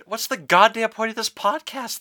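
high, exasperated demand; mock outrage. Host to cohost who isn't straight, mock outrage. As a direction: act scandalized with pitch shooting up.

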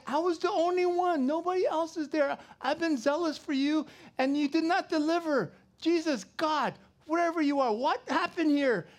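loud, high pleading prophet; Elijah's complaint. Acted. Elijah complaining to God, loud high lament.

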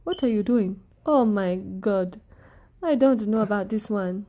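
put-on African accent, deliberate. Shown as a demonstration. Co-host showing off accents on request, mock African accent. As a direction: try out an amateur African accent. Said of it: "How, how about African?".

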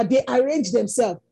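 usual speaking voice

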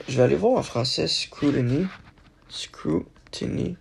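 ordinary delivery

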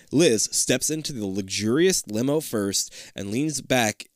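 ordinary delivery

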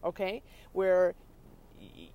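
ordinary talk

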